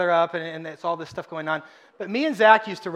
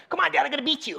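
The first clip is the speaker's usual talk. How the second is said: put-on little girl; 8 semitones higher, faster than usual. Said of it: "I see the car starts running".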